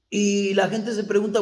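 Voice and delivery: ordinary talk, nothing marked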